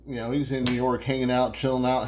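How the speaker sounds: normal talking voice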